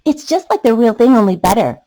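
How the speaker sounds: put-on loud, fast child voice; giggly excited kid. A narrator's reading. Kid giggling over the new balloon, loud and quick. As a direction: blurt it out thrilled.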